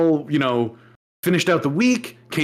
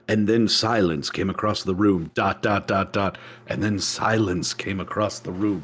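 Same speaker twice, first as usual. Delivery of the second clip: low, dramatic novelist's narration, dragging each dot; mock novel narration; 7 semitones lower, 4 dB quieter than usual. Mocking a coworker's self-important novel in a low, grave narrator voice. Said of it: "every sentence of her novel".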